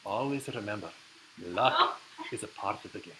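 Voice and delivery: imitating an earnest Irish golfer; solemn advice in a lightly put-on Irish accent; serious Irishman passing on wisdom. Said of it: "I don't wanna do an Irish accent".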